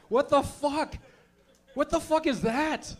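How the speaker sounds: high, slow disbelief; baffled protest, drawn out; mock outrage. Real, not acted. Comic to audience reacting, mock-outraged.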